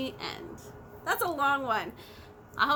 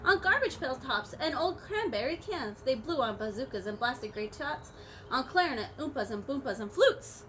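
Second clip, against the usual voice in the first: lively picture-book narration; bouncy read-aloud storytelling; storybook reading; 2 semitones lower than usual. A narrator's reading. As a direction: read it out to the children. Reading Horton aloud to class.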